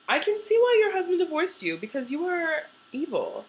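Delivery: mock angry retort. Talking back at the TV mom, mock indignant. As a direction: snap back at her, mock offended. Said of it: "I was so pissed".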